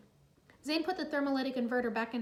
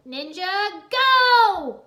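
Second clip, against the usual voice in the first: high, loud rallying shout; rally the team; battle cry; 8 semitones higher, 14 dB louder, much slower than usual. Acted. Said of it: "Jay shouted".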